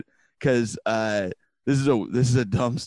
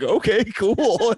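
high, slow, laughing through it; laughing okay; 10 semitones higher, 3 dB louder, much slower than usual. Retold or imitated. Byrne shrugging it off, laughing.

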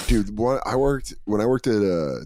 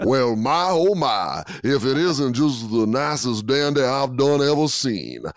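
hammed-up Southern drawl; 2 semitones higher than usual. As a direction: ham up an old Southern charmer. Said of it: "gone full Foghorn Leghorn".

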